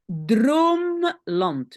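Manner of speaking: "Droomland" drawn out, split after Droom; split-word demo. Shown as a demonstration. Teacher separates the compound for learners, slow careful demo. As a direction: hold "Droom", then place "land" separately. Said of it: "oui, je sépare ici".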